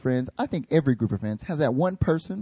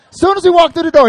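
much higher and louder, racing to the punchline; loud storytelling build; 13 semitones higher, 12 dB louder than usual. Retold or imitated. Pastor telling friends about Tyler's entrance, loud and high. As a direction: build it up big like the moment hits.

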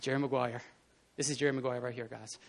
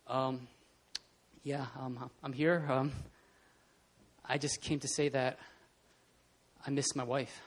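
soft, low, hesitant fumbling for words; halting stammer; 6 semitones lower, 10 dB quieter than usual. Acted. Tom Cruise facing the silent room, soft halting stammer. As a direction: falter quietly between long pauses. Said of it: "stuttering 'cause he's trying to".

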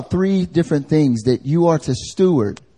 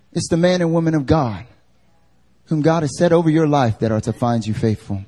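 ordinary talk

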